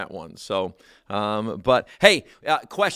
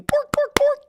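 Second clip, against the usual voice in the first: very high and louder, a shrieked pork; squeaky chef cry; 6 dB louder than usual. Retold or imitated. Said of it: "imitating the Swedish Chef".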